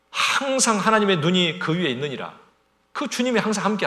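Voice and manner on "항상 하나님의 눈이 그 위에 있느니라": higher, louder proclamation; ringing scripture quote. Real, not acted. Preacher proclaiming God's watchful eye, loud and raised. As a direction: lift the verse up and out.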